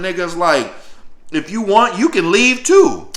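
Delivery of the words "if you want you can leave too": higher, slower mock sergeant dare; a hint of a sergeant calling his bluff; lightly voiced taunting sergeant. Drill sergeant challenging him.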